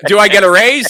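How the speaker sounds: hopeful ask. Retold or imitated. Teen clerk to manager, mock eager ask for raise. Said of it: "and I'm like".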